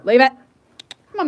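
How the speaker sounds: usual speaking voice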